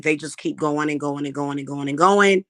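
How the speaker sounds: ordinary talk, nothing marked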